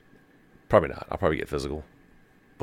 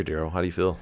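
ordinary talk

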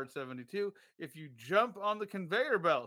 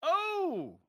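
high startled oh; surprised cry; 12 semitones higher, 3 dB louder than usual. Real, not acted. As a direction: react to bad news. Coin lands on heads.

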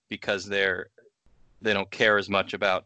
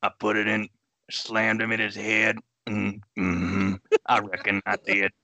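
Sling Blade character. Pretend. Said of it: "Sling Blade, the Billy Bob Thornton movie".

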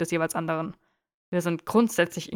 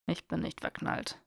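breathless whisper, much softer; whispered denial; 8 dB quieter than usual. Acted. Draco denying to Theo he is in love, whispered. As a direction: hiss the denial under his breath.

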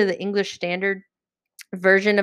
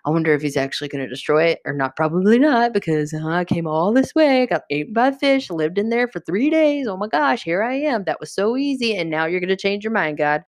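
whiny, sulky grumbling with swooping pitch; moping, pouting. Acted. Jonah grumbles to God from the hillside, moping and pouting. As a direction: sulk and complain like a pouting prophet. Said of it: "just moping and pouting".

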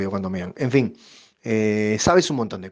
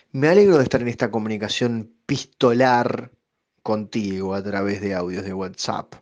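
ordinary talk, nothing marked

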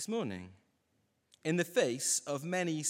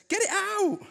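put-on much higher, louder wailing child; crying toddler demand; 7 dB louder than usual. Scared toddler demanding dad remove shampoo, high wail. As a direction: wail it like a frightened little boy. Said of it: "And he says".

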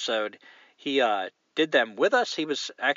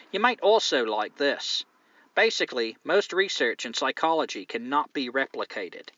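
put-on Australian accent; 3 semitones higher than usual. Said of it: "if I just do an English accent, it comes out Australian anyway".

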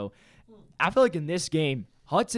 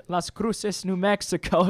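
Las Cruces said laughing, pitch raised, English way; gringo accent; 5 semitones higher, 4 dB louder than usual. Shown as a demonstration. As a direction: Las Cruces again, playful gringo take. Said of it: "You can say it like a gringo".

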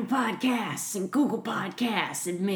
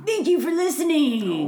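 wrestling promo; 6 semitones higher, 3 dB louder than usual. Pretend. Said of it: "You're so scary".

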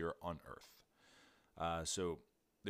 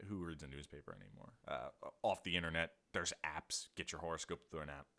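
ordinary talk, nothing marked

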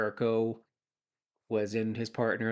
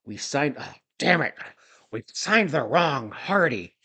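jowly voice; 2 semitones higher, 4 dB louder than usual. Retold or imitated. Jowly boss regretting the Hardy signing.

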